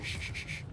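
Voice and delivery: soft, repeated shushing; hushing. Pretend. Luring someone over here in secret, hushing. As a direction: hush them with quick shushes.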